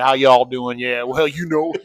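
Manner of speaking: loud, lifted Alabama twang; hammed-up Arkansas or Alabama accent. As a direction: greet folks like an Alabama local. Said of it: "like, Arkansas, Alabama, which is kinda more like".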